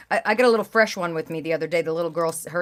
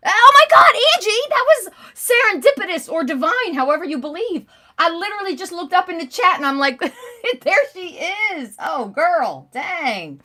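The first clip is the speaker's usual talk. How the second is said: much higher and louder, thrilled to see a friend; excited squeal; 10 semitones higher, 8 dB louder than usual. Real, not acted. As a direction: shriek with joy as Angie shows up. Viewer Angie appears in chat.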